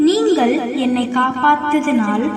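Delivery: put-on a gentle heavenly being blessing the children; the whale reveals itself as an angel, low, soft and solemn. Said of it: "நான் ஒரு தேவதை".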